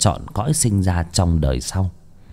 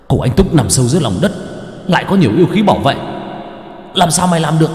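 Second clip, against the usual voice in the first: startled panic; 7 semitones higher, much faster than usual. Acted. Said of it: "Người đàn ông giật mình".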